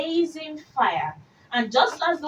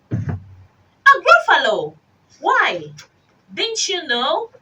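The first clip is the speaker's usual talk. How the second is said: mock surprise; 7 semitones higher, 4 dB louder, slower than usual. Mouse feigns surprise.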